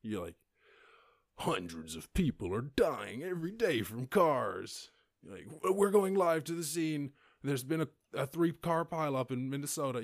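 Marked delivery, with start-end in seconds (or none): 1.4-4.9 slow, grave parody newsreader; mock news anchor. 5.6-10.0 higher, louder breathless field reporter; mock live reporter. Parody live news report.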